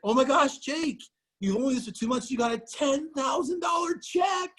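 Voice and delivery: very high, fast gushing mother; excited mom. Retold or imitated. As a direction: squeal it like a thrilled mom. Mom voiced, growing excitement.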